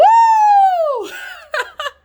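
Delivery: loud, high squealing laugh; high shrieking laugh. Real, not acted. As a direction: burst into a shrill whoop of laughter. Whoops and laughs at herself.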